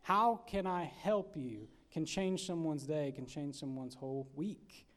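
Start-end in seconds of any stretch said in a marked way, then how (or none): 0.0-1.7 slow, weighty question; slow, deliberate. Shown as a demonstration. Preacher stressing the key question to the congregation, slowly. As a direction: lay each word down slowly.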